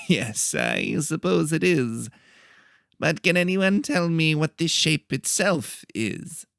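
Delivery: high, slow, nasal lecturer voice; a fussy little teacher calling the class to order; nasal professor. A narrator's reading. Professor calling the class to sit down, nasal and slow. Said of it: "He talked in a nasally voice".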